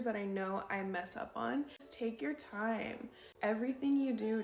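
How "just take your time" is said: slow, unhurried coaching; slow advice. Shown as a demonstration. Coaching viewers to slow down, said slowly. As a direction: model the slowness as you say it. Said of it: "advice to speak slower".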